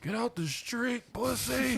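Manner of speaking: mock driver yell. Yelling at cars in alleyway.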